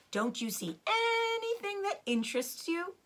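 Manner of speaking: high, teasing fox voice; playful hinting father fox; sly Mister Fox. Acted. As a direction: coax them to look around, knowingly.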